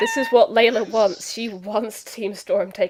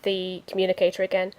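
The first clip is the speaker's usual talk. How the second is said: normal talking voice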